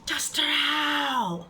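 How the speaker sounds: very slow, wailing shout; drawn-out yell. Pretend. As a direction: stretch it into a long hollering wail. Chanting while huffing duster.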